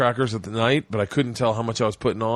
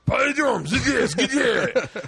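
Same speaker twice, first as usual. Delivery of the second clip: high-pitched mock Russian bark; imitating a cop barking gibberish orders; imitated cop's yell; 10 semitones higher, much slower than usual. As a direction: yell it like an angry foreign cop. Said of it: "when the cop yelled at me".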